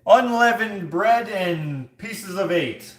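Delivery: slow, loud, high herald's call; jester crier. Acted. Jester hawking wares at a Renaissance fair, slow loud cry. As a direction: draw it out for the crowd. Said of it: "I always think of a jester".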